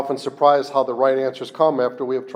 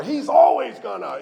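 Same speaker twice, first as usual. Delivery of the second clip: ALWAYS pitched high and stretched; mock grievance with a high punch on always; ego's complaint; 7 semitones higher than usual. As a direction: wail the word "always" in accusation. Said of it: "that's definitely the ego".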